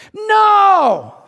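high, shouted "No!"; loud refusal. Pretend. Preacher shouting down an unwanted critic. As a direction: slam the door on the critic.

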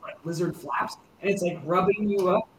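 normal talking voice

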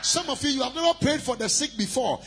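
loud, high fervent preaching; preaching at full force. Acted. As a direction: shout it like a revival preacher. Preaching climax to congregation.

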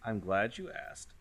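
ordinary talk

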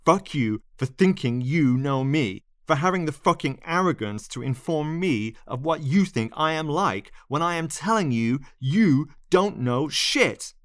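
high, furious tirade, hitting key words; snapping. A narrator's reading. Lionel berates the narrator for presuming, snapping in anger. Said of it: "Lionel snapped".

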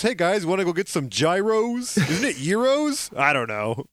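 a lightly voiced, upbeat old comics writer, unsure how to say gyros; mock a cheery guy stumbling over a food name; a hint of a chummy old-timer; Bill Finger impression. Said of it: "what a very specific, uh, impression".

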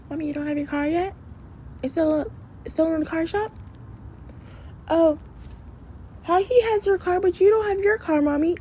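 put-on very high-pitched pestering kid; mock child voice. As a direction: imitate your child nagging mommy, way up high. Voices child nagging about car.

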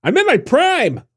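squeaky offended protest; high indignant protest. Real, not acted. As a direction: jump way up, indignant. Indignant reply to being called old.

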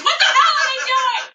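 high, loud mock-outraged yell; outraged shout. Comic ranting to the audience about eating pangolins, shouting high and loud. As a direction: blast it at the crowd in disbelief.

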